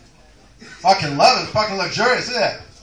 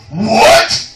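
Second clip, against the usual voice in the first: menacing inmate; 3 semitones higher, 15 dB louder than usual. Acted. Bubba answers threateningly.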